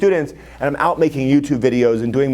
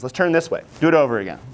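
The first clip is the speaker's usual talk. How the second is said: normal talking voice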